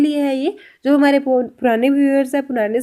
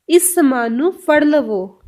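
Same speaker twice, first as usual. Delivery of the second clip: very slow, careful phrase; very slow Punjabi demo; much slower than usual. Shown as a demonstration. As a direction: say it slowly to a small child. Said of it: "Punjabi में कैसे बोलेंगे?". (छोटे बच्चे को धीमे-धीमे पंजाबी में)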